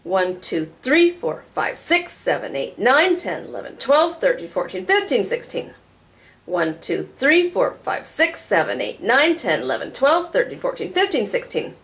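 "one, two, three" to "sixteen" with the accented numbers leaping up in pitch; accented counting. Shown as a demonstration. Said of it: "So we could count all the sixteenth notes".

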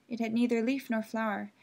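usual speaking voice

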